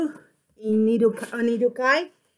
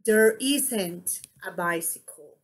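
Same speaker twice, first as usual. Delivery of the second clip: low falling finish on "bicycle"; statement intonation; 4 semitones lower, 3 dB quieter than usual. Shown as a demonstration. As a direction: let "bicycle" sink after "isn't". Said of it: "You see my intonation?".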